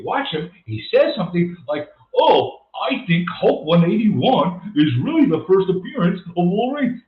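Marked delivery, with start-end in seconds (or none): 2.2-7.0 mock moron. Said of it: "because he's a moron".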